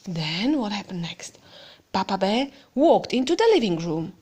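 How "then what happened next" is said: soft suspenseful whisper; hushed question. Acted. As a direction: draw the child in with a whisper. Whispered aside to child.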